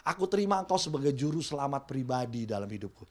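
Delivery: a hushed personal prayer. Pretend. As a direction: pray it quietly and sincerely.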